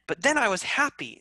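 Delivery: demonstrating sudden happiness; happy. Shown as a demonstration. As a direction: perk up brightly into happiness. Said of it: "use your emotions".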